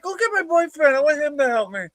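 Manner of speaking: very high mock female voice; imitating girlfriend. Girlfriend sending staff for help, high mock voice. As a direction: put on a squeaky girlfriend demand.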